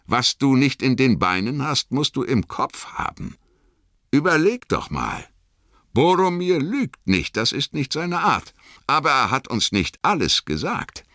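put-on a young hobbit reasoning aloud, urgent; Sam's voice. A narrator's reading. Sam reasons aloud by the river, eager hobbit voice.

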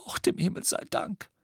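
high, breathy, tearful thanks; sobbing with relief. A narrator's reading. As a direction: sob it out in relief, high and airy. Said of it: "schluchzte Misses Weasley". (vor Erleichterung schluchzend)

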